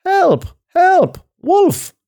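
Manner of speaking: high, loud cries for help; shouting. A narrator's reading. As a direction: shriek each word separately, very high.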